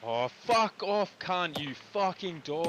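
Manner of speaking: drawn-out furious gamer yell; slow, high, raging at the screen; angry. Real, not acted. Raging at game enemy.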